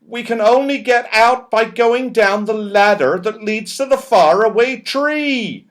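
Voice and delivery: high, loud reply called over the din; shouted back. Acted. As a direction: yell it back while tumbling. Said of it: "shouted back Moonface".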